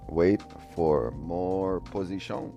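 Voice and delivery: usual speaking voice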